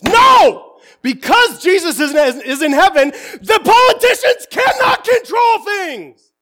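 high, impassioned preacher's shout; full-volume pulpit yelling; yelling quite loud. Real, not acted. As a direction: yell it at the congregation in defiance. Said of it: "I'm yelling quite loud".

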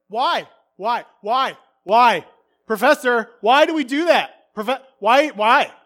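loud, insistent, repeated questions; pestering student. Retold or imitated. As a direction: badger the professor, eager and relentless. Imitating himself pestering professor.

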